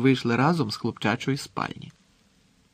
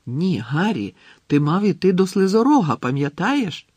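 urgent insisting, rising louder and higher; insistent; 6 semitones higher, 5 dB louder than usual. A narrator's reading. Hermione reminds Harry of his task, insistent. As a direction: press the reminder on him. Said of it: "наполягала Герміона".

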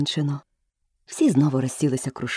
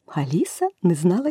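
ordinary delivery

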